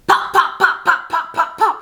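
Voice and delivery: quick high popping mouth sounds; imitating gunshots. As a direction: pop it out like far-off gunfire. Gunshots heard in the club.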